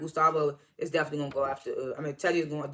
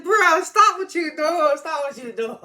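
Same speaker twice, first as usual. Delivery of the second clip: high, loud, drawn-out plea; exasperated outburst; 9 semitones higher, 8 dB louder, slower than usual. Retold or imitated. Viewer pleading with a character on screen, exasperated outburst. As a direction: cry out in hammed-up disbelief, stretching the words. Retold outburst at the show's twist.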